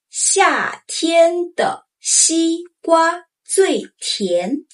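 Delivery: 夏天的西瓜最甜 very slowly; slow model sentence. Shown as a demonstration. Teacher modeling for learners to try, very slow. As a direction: spell out every syllable. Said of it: "Try this".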